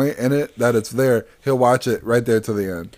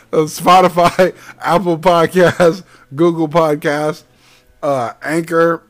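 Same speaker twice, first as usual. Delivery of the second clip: slow announcement; 3 semitones higher, 4 dB louder, slower than usual. Real, not acted. Slow plug of platforms.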